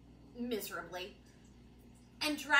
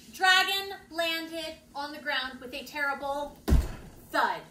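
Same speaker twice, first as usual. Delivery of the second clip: usual speaking voice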